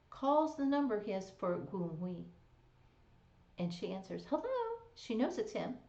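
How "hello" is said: a sweet high "Hello." sung into the phone; lilting phone hello. Retold or imitated. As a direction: answer the phone all sugary, way up high. She answers the phone.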